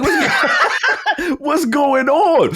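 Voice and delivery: high-pitched, drawn-out bewilderment through laughter; comic alarm. Retold or imitated. Strapped-in patient questioning the staff, comically alarmed. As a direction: wail the question, half laughing.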